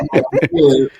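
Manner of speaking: sheepish laughing admission. Real, not acted. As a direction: giggle out a guilty confession. Confessing with a laugh.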